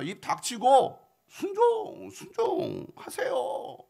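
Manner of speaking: drawn out, each word pressed hard; slow emphatic command. Acted. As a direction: spell out obedience slowly, no arguing. Servants order general to obey.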